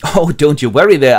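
high, very loud announcement; eager messenger. Acted. Messenger announcing himself, high and very loud. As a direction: proclaim yourself. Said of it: "the messenger replies".

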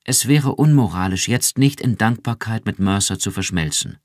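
laut und höher, beschwörend; eindringlich. Acted. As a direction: mit Nachdruck widersprechen. Iran erwidert eindringlich. (Iran widerspricht Rick eindringlich)

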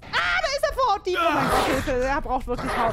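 panicked shouting for help; panicking gamer. Real, not acted. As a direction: scream for help while fleeing. Monster chasing player in game.

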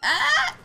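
sudden high loud scream; startled scream. Real, not acted. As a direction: yelp as the car is hit. Scream when killed.

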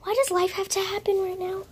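loud, high-pitched exasperation; exasperated outburst. Real, not acted. Girl filming, interrupted by her brother, exasperated outburst. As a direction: complain loudly at the interruption.

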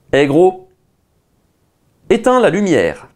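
clear, firm command to a device. Real, not acted. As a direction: speak it like telling a smart speaker what to do. Commanding the voice assistant.

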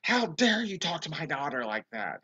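imitated outraged mother, high; going off. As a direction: play her indignant telling-off. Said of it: "this woman goes off".

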